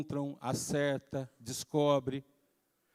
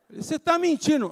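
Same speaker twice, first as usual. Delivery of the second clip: mock scolding; 10 semitones higher, 5 dB louder than usual. Father accuses child.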